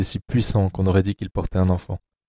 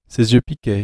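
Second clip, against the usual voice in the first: plain reading voice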